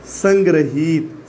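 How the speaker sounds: संग्रहित slowly, every syllable apart; slow careful word. Shown as a demonstration. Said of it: "अब देखिए बच्चों".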